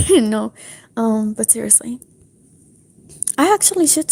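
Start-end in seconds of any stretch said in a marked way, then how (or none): none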